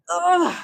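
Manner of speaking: high loud groan of relief; exhausted groan. Real, not acted. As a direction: groan as the burn ends. Finishing final hold, exhausted.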